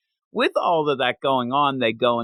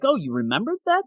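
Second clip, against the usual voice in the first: surprised father; 9 semitones higher, faster than usual. Retold or imitated. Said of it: "he's actually surprised".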